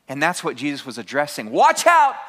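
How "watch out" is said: high and loud; ringing alarm; loud warning. Acted. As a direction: shout the warning at the congregation. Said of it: "preacher's warning".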